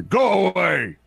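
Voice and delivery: gruff high shout from inside; grumpy shout. Acted. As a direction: bark it like a grumpy smith. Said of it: "You hear".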